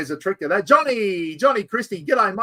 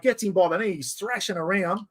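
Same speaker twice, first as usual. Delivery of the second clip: ordinary delivery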